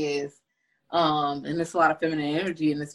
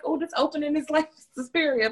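higher, laughing through the thought; amused realization; 10 semitones higher than usual. Retold or imitated. Recalling her own reaction to the opening, amused and laughing. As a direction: catch yourself with a laugh. So I'm like.

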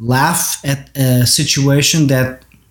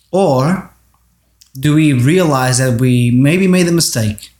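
normal talking voice

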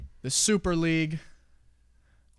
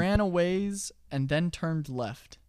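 slow, deliberate riddle reading; slow riddle telling; 3 semitones higher, slower than usual. Acted. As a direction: lay out the riddle slowly.

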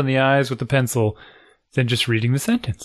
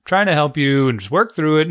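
normal talking voice

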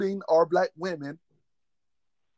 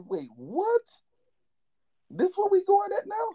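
high-pitched, incredulous questioning; retold disbelief; 13 semitones higher than usual. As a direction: push the voice way up, baffled. Retelling disbelief at what he heard.

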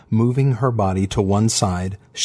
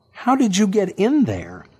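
exasperated question; 10 semitones higher than usual. A narrator's reading. Megan starts over.

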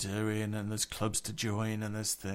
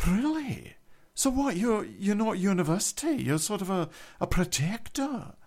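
a lightly raised, wide-eyed generic female voice; 7 semitones higher than usual. Retold or imitated. Scottish guy quizzing the narrator, in a lightly raised generic voice. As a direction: play him up higher, fascinated and eager. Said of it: "I have to use the female generic voice for this".

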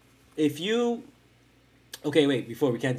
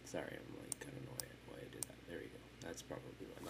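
much softer mumbling away from mic; muttered aside; 10 semitones lower, 24 dB quieter, much faster than usual. Real, not acted. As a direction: mumble to yourself off to the side.

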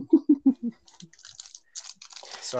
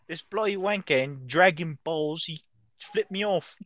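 higher, louder, slow mock Brit complaint; mock British accent; 5 semitones higher, 5 dB louder than usual. British viewer complaining about anime, mock British accent. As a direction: grumble like a cartoon Brit.

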